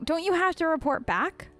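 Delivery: usual speaking voice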